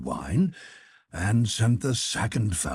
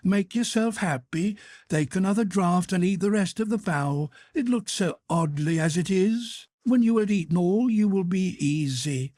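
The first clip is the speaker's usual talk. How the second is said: higher, coaxing voice for the greedy cook; a cook's tipsy temptation; Gretel's sly self-talk; 10 semitones higher than usual. Acted. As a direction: talk yourself into another bite. Gretel talking herself into eating.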